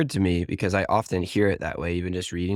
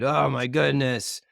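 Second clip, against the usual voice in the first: higher, drawn-out mock exasperation; imitated frustration; 6 semitones higher, slower than usual. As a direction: drag it out as if fed up with them. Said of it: "angry tearing down and frustrated".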